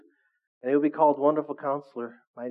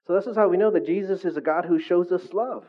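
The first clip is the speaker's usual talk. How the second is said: normal talking voice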